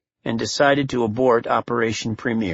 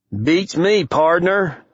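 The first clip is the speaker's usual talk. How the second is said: American Western drawl, pitched up; cowboy twang; 7 semitones higher, much slower than usual. Acted. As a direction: play the laconic cowboy. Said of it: "Swanson said with a cowboy twang".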